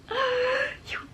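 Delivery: squeaky gasp of disbelief; real shocked gasp; high gasp. Real, not acted. Realises what plant it is.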